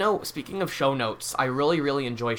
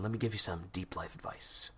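usual speaking voice

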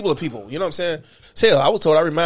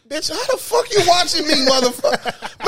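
mock angry retort; 11 semitones higher than usual. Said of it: "It's like".

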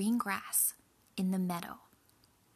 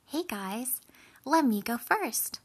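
lightly voiced little goat, higher pitched; youngest goat; 5 semitones higher, 6 dB louder than usual. A narrator's reading. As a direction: pipe up as an eager young goat. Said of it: "said the youngest goat".

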